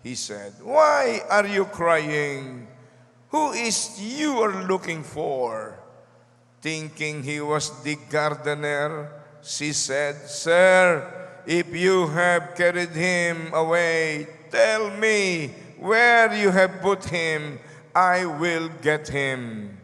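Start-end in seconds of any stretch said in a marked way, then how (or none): 0.6-5.8 slow, louder, drawn-out asking; slow, weighty question to a grieving woman; gentle question. Acted. Jesus questions weeping Mary. 10.3-19.7 loud, slow, desperate plea; a grieving woman pleading loudly; pleading cry. Acted. Mary pleads with supposed gardener.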